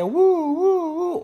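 high wailing siren sound; mock ambulance siren; siren imitation.

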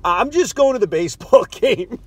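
a laugh breaking into the quote; nervous laugh through the quote. Retold or imitated. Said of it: "So now I say".

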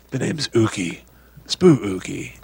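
lightly voiced horror villain intro; a playful ghoul naming himself; mock spooky character. Host pretending to be a cheesy horror character, low mock-menace.

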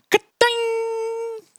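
high, sharp sound-effect ka-ding; mock ka-ding sound. Storyteller mimics the booby trap springing, high ka-ding. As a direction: ring it out like a snapped trap line.

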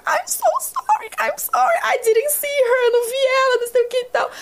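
pedido de desculpas agudo e arrastado; desculpa aflita. Retold or imitated. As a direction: implorar perdão à mãe, bem esticado. Apologising to child's mother. (voz aguda e lenta de quem pede desculpas à mãe da criança)

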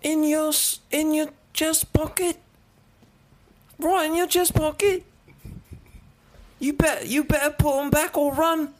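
high, hammed-up British accent; mock British grocer, voice pitched well up; British shopkeeper. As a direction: play a startled British clerk catching a thief. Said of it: "a British man that works at a grocery store".

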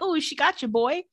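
put-on high teasing hoot from the boys; laughing at a buddy who got burned.

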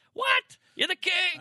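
high, loud disbelieving cry; shocked that he'd say that; incredulous disbelief. Retold or imitated. Acted quote of admiring fans.

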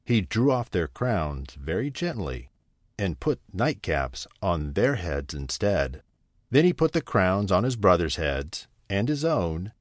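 ordinary delivery, nothing marked

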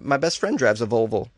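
ordinary talk, nothing marked

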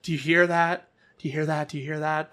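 soft, hushed, savouring the moment; hushed awe. Real, not acted. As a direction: quietly relish it. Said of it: "that's joy".

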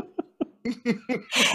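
high-pitched chuckle of self-amusement; real giggly laugh at yourself. Real, not acted. Laughs at own retold mishap.

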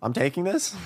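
nervous disbelief. Real, not acted. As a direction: sound unsure and alarmed. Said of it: "world's most sour candy".

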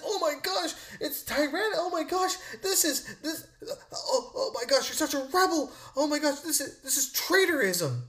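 high-pitched, stammering mock panic; mock scandalized critic. Host mimicking critics of his rebellion talk, high mock outrage. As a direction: imitate a flustered pearl-clutcher sputtering in shock.

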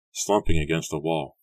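plain reading voice

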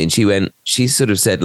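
normal talking voice

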